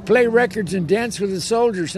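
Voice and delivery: usual speaking voice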